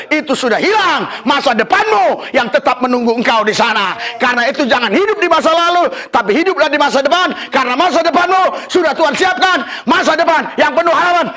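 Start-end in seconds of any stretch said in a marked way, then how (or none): 4.2-11.3 building to a shout. Real, not acted. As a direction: climb from urgent preaching into a jubilant cry. Preacher builds to a climax.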